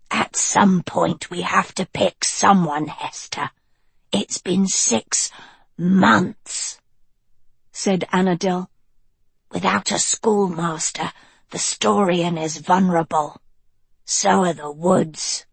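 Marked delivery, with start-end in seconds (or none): 0.1-6.8 put-on cool, level young witch; Annadil voice. 9.5-15.5 lower, measured witch voice; cool, level young witch; Annadil voice. Acted.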